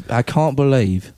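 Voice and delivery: slow put-on British accent. Shown as a demonstration. Host showing a friend the accent, slow British accent. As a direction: draw it out in mock British English. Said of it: "blast off on a British accent".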